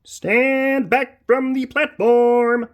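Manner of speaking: very slow, loud, high shout; loud slow warning. Acted. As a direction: bellow the warning slowly. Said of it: "the train driver shouts".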